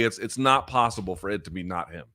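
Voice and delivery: ordinary delivery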